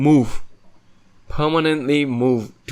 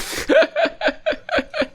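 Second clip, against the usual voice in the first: laughing. Real, not acted. Friend's odd reply cracks him up.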